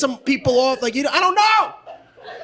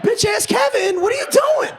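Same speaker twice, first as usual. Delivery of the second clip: mock exasperated shout; 10 semitones higher than usual. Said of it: "I'm like".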